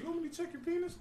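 lightly voiced doctor, pitched high; mock doctor. Friend mimicking his doctor for the crew, pitched high and mock-polite. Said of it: "My doctor always like".

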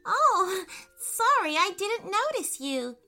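high, startled apology; flustered little-girl sorry; apologetic. A narrator's reading. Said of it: "said with an apologetic voice".

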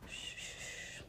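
soft breathy shush; soothing shushing. Acted. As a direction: hush him gently. Soothing baby during wipe.

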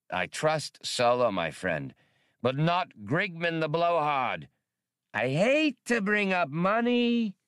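high, oily trader voice; smooth-talking alien hustler; lightly voiced green primate trader; alien wheeler-dealer. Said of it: "somewhat embarrassed".

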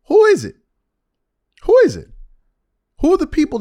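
high, loud challenge; insistent demand. Acted. Host calling out unnamed media critics, insistent and high.

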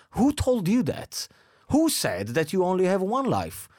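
high, indignant disbelief; incredulous. Real, not acted. Friend challenges a common saying, incredulous. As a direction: demand who said it, voice leaping up.

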